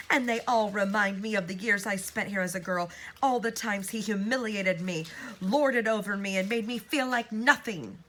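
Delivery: loud, high bitter yell. Acted. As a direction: yell out years of grievance. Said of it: "beginning to yell once more".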